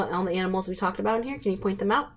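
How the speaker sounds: ordinary delivery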